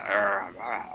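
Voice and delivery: low rough growling voice; raspy voice. Retold or imitated. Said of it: "imagine if I had that voice".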